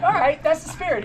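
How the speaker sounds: high-pitched imitation of an approving pacer; encouraging. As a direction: cheer on a buddy who gave in. Pacer cheering runner's attempt.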